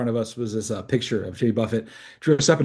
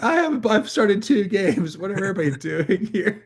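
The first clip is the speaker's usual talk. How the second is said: high goofy voice; 8 semitones higher than usual. Retold or imitated. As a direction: voice him high and dazed. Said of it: "he's like".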